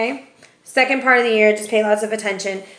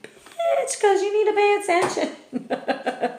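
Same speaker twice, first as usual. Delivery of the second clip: much higher, sassy shot back through a laugh; sassy comeback; 11 semitones higher, 4 dB quieter, slower than usual. Retold or imitated. The reader answering her friend with a high sassy laugh. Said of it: "And I'm like".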